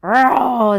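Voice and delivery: loud groaning growl; a rumbling bear's sound. Acted. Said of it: "the old bear growled".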